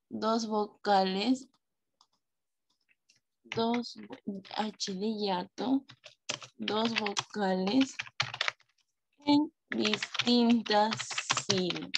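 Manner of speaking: stretched words with gaps, dropping at the end; slow dictation. Real, not acted. Teacher writing the hiato definition for pupils, slow dictation. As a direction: say it at writing speed.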